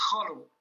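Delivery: Khalo said alone for repeating, falling at the end; model pronunciation. Shown as a demonstration. Teacher asking the learner to repeat Khalo, model pronunciation. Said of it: "répète après moi".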